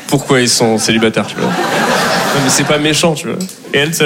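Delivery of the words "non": usual speaking voice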